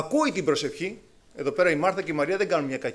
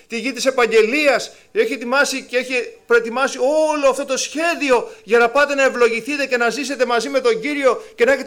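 louder rebuking sermon voice; fervent preaching; 6 semitones higher, 7 dB louder than usual. Acted. Preacher rebuking people who weep for meat, fervent. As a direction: preach it hard at the grumblers.

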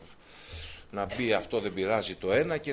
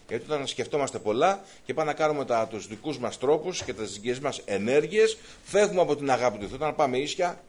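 normal talking voice

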